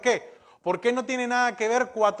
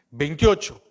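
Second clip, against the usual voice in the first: ordinary talk, nothing marked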